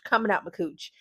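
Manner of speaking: ordinary delivery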